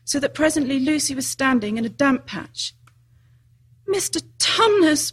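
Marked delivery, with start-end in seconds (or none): 3.9-5.1 high, drawn-out call; a restrained narrator's bawl. A narrator's reading. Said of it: "bawled Lucy in his ear".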